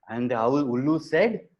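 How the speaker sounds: ordinary delivery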